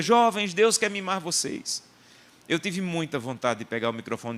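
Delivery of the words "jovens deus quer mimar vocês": high, hyped-up singer's call; raised-pitch mock rallying call; imitated shouting. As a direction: mimic her repeated cry to the crowd. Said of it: "E ela repetia".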